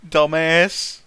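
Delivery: ordinary delivery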